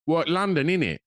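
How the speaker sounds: quick imitation of a young Londoner's "What, London, innit?"; mock street accent, lightly done; a hint of a London lad; mimicking London slang. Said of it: "and he went".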